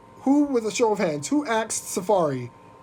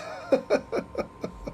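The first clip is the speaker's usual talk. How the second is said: quiet wheezing giggle; helpless laugh; 6 dB quieter than usual. Real, not acted. Host cracking up at her own rant, wheezy laughter. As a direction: crack up softly, lost for words.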